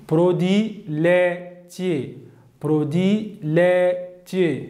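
slow modelled produit laitier; French model phrase. Shown as a demonstration. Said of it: "Süt ürünleri".

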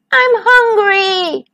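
slow, high drawn-out whine; stretched-out high moan. A narrator's reading. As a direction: whine like a hungry cub. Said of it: "whined Smells".

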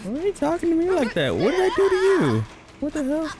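how dare you, stung and squeaky; offended protest. Real, not acted. NPC insults him, he protests.

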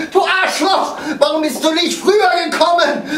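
high, loud yell of accusation; furious shout. Acted. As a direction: scream at them for coming too late. Yelling at the late police.

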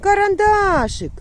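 very high, loud and slow with joy; delighted reunion. Real, not acted. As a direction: gush over the found dog. Finding her dog at last. (радісно вигукує, знайшовши собаку)

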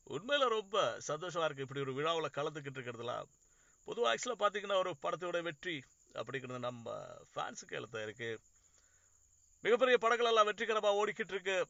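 high, loud, brisk Tamil star stage greeting; Surya impression. Film star taking the mic at a packed audio launch, high and booming. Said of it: "പുള്ളി mic ... ഇങ്ങനെ പറയും".

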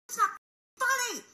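very high, loud, exasperated scream; furious scream. Retold or imitated. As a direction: reenact screaming at someone laughing. Yelling at laughing kids, retold.

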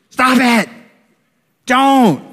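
a mock stern parent shout; stern warning shout. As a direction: snap at them to stop. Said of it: "that's the voice".

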